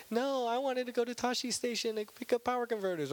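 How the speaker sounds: high, whiny protest; Luke Skywalker, whining. Acted. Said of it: "He's, like, such a whiner".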